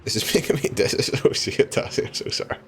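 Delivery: wheezing through the giggles, barely voiced; words squeezed out between laughs; breathless laughter. Real, not acted. Streamer to chat, laughing breathlessly at the game. Laughing fit while playing.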